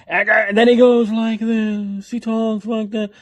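high, loud mock screaming voice; Donald Trump. Host shows how a politician screams, mocking high yell. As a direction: stretch it out in a strained, hammed-up yell. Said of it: "When he's screaming, he's like".

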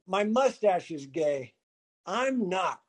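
quiet deadpan punchline. Retold or imitated. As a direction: say it softly and flatly, like it is obvious. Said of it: "And I said".

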